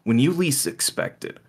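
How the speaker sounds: ordinary talk, nothing marked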